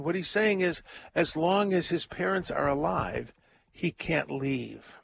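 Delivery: usual speaking voice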